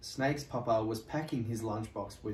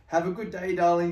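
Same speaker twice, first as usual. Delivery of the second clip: fond mother; 7 semitones higher, 8 dB louder, faster than usual. Acted. Mama seeing Sparrow off.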